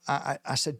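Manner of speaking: ordinary delivery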